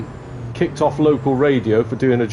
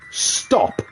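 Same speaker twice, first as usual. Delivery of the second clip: higher, firm single word; mum's model of a firm command; firm instruction; 8 semitones higher than usual. Acted. Mum coaching her boy to halt the van, firm. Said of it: "you're gonna say".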